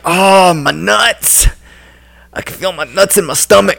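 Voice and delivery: mock-pained boxer, slightly high and soft; pained, held-in mock complaint; hurt fighter. Mimics fighter hurt by body shot.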